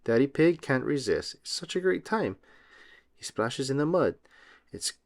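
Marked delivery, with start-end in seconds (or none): none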